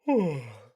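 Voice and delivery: loud real yawn slipping out; a genuine yawn caught mid-reading. Real, not acted. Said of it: "I actually turned to a real yawn".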